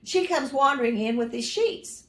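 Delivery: ordinary talk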